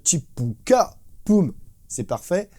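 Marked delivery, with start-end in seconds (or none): 0.0-1.0 Chipuka sung out slowly as a drum fill; vocal drum fill. Retold or imitated. Drum teacher imitating the studio fill, slow beatbox. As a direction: spell out the studio fill with your mouth, slowly. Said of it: "il le fait juste".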